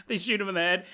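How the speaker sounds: normal talking voice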